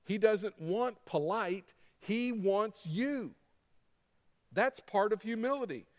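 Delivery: ordinary delivery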